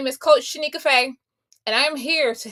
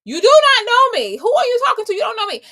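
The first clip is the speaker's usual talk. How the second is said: much higher and louder outburst; angry retort; 11 semitones higher, 8 dB louder than usual. Retold or imitated. Answering the rude old lady at the clinic, loud and angry. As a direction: snap back at her like your blood is boiling. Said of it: "my blood was starting to boil".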